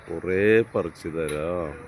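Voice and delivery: കുറേ drawn out slowly, each word stretched; slow, coaxing promise to a crying child. Acted. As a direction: slowly spell out "കുറേ പറിച്ചു തരാം" to calm the little one. Brother soothing crying little one.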